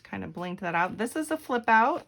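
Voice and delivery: ordinary delivery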